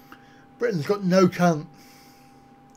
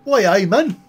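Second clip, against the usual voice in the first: a hearty, louder Geordie Newcastle greeting; louder Newcastle Geordie accent demo; 3 semitones higher, 6 dB louder than usual. Shown as a demonstration. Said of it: "I can do Newcastle".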